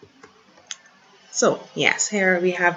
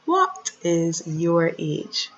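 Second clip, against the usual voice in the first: slow clear question; much slower than usual. Shown as a demonstration. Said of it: "Can anyone guess".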